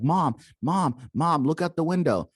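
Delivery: urgent cry to mom, lightly acted; retold freaking out. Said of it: "she starts freaking out".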